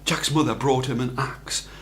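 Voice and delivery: plain reading voice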